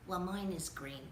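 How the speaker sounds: usual speaking voice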